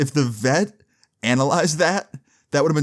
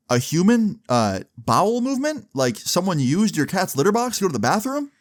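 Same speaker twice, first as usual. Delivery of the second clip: shocked disbelief; 7 semitones higher than usual. Retold or imitated. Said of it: "I hear the woman through the phone go".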